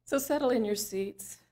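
unhurried, soothing meditation guide with long pauses; guided meditation. Real, not acted. Practitioner guiding the room and online listeners through breathing, calm meditation voice. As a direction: lead them gently inward, leaving long silences between phrases.

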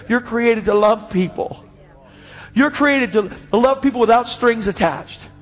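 high, slow, ringing exhortation; fervent preacher's charge; impassioned preaching. Real, not acted. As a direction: drive each phrase home to the congregation, building in force. Preaches directly to congregation.

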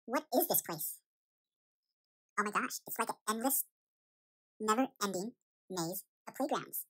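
ordinary delivery

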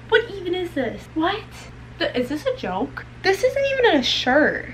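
high, indignant complaining; outraged disbelief. Real, not acted. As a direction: scold the shirt in disbelief. Outraged at bad shirt.

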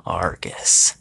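low mutter to himself. A narrator's reading. Sam recognises his foe Argus, muttering to himself. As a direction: mutter it under your breath. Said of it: "Sam muttered to himself".

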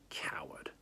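low, soft, scornful; a quiet contemptuous scoff. A narrator's reading. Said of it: "Sophie thought scornfully".

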